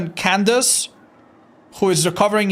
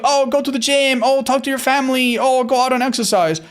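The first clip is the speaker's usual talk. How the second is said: higher, singsong imitation of nagging advisers, lightly mocking; mocking the usual advice; 7 semitones higher than usual. Streamer mocking the advice everyone gives the heartbroken, in a higher singsong voice. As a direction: parrot the well-meaning advice back with a bit of an eye-roll. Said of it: "everyone's gonna tell you".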